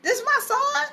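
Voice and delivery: very high-pitched, incredulous disbelief; imitating a father baffled and upset, stammering. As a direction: play a flabbergasted dad, voice shooting up. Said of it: "his father was very upset".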